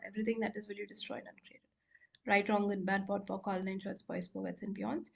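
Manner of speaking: soft, practised rote formula; clearing statement recitation. Real, not acted.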